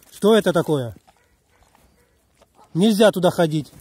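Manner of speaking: loud, stern telling-off; scolding a dog. Real, not acted. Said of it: "Сейчас надо будет его ругать".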